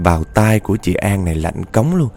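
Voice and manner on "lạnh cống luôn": usual speaking voice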